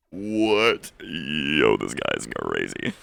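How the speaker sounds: drops low and slow, stunned; stunned disbelief. Real, not acted. Streamer reacting to a rude message, stunned. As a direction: react like you can't believe it.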